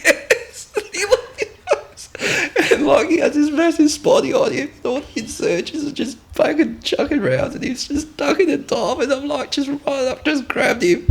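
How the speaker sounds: high, squeaky voice breaking into laughs; telling through laughter. Real, not acted. Soldier recounts team leader dodging rounds, high laughing delivery. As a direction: keep the story going while cracking up. Cracking up telling funny story.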